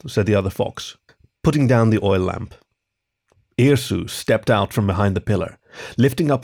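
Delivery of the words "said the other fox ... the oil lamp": plain reading voice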